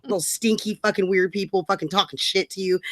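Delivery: ordinary talk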